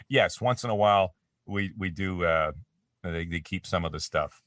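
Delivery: normal talking voice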